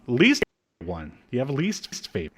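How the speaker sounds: ordinary talk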